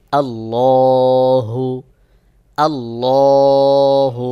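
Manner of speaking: আল্লাহু with a full, heavy lam, drawn out slowly. Shown as a demonstration. Teacher showing students the heavy lam in আল্লাহু. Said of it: "মোটা করে পড়তে হয়".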